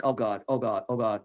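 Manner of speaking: low, soft "oh no" to oneself; retold sinking dread, under the breath; muttered dread. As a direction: murmur it as trouble starts. Dreading a fight starting.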